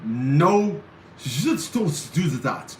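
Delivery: put-on slow, halting European-accented orders. Imitating a stern college professor from Europe, European accent. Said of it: "that's how she talked".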